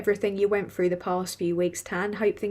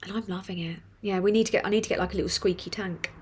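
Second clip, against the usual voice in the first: usual speaking voice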